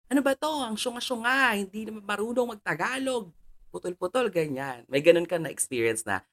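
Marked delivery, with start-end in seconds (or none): 0.1-4.3 high-pitched pretend telling-off; pitched-up mock berating; mock scolding. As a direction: scold like an exasperated director, without really shouting. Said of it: "parang nasigawan ka na ng todo-todo".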